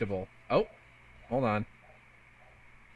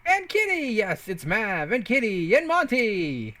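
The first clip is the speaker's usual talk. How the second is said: high, drawn-out cheerful call of names; excited greeting; 6 semitones higher than usual. Real, not acted. Streamer greeting chat members, excited. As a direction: greet each name with a bright lift.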